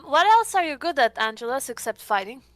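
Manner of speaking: ordinary talk, nothing marked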